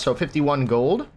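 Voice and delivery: ordinary delivery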